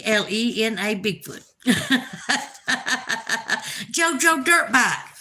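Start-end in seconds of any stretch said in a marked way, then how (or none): 1.7-5.1 real laughter while reading; bursting laugh. Real, not acted. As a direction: crack up at the name. Laughs at a new name.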